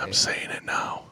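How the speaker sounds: hushed, whispered prediction; secretive whisper; conspiratorial whisper. Pretend. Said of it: "Why are you whispering".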